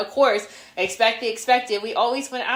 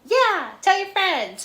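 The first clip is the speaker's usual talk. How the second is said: upbeat plug; 8 semitones higher, slower than usual. Real, not acted. Podcast outro plug.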